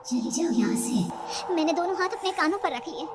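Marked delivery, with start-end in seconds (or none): 0.0-1.5 slow, eerie stifled whisper; दबी हुई आवाज. Acted. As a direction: whisper it slowly, like a voice at the ear. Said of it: "दबी हुई आवाज में धीरे से". (दबी आवाज़ में धीमी फुसफुसाहट)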